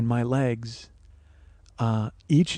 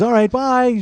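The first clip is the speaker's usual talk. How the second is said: higher-pitched imitation of her farewell; chirpy retold goodbye; 8 semitones higher, 4 dB louder, slower than usual. Imitating the woman leaving for her room, chirpy high goodbye. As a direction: toss off a light, high bye.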